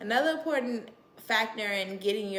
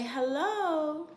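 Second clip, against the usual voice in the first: put-on high coaxing baby talk; 6 semitones higher than usual. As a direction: coax the baby to say hi.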